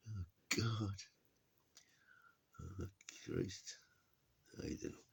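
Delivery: breathy whispered mutter; whispered aside. Real, not acted. Muttering to himself about the Americans. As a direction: mutter it under your breath.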